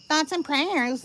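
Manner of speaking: high sing-song mock sympathy; mocking imitation. Streamer mimics how the woman said it to her, mockingly. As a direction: parrot it in an insincere high voice. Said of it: "it's said like that".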